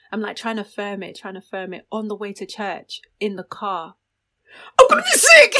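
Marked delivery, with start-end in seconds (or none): 4.8-5.5 high, loud mock wail; re-enacted urgent cry, far louder and higher; retold panicked outburst. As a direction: blurt it out in a comic panic. Retelling drunken panic in the car.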